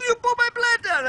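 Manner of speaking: high-pitched imitation of an angry yell; mock screaming. Retelling a neighbour yelling about his plants, mock screaming. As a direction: screech it in mock outrage. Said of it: "he's screaming at us".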